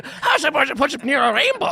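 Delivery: high mock voice.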